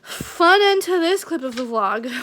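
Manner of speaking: slow, ironic fanfare to close the rant; sarcastic cheer. Real, not acted. Ironic sign-off after venting.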